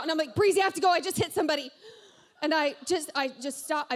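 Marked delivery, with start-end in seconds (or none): 0.3-1.7 high, loud mock panic; retold freak-out. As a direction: blurt it out as if panicking on the phone. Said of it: "I'm, like, freaking out".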